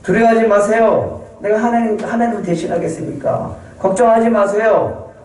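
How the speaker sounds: a brother begging them not to fear; pleading brother Joseph. Acted. Joseph reassuring brothers again.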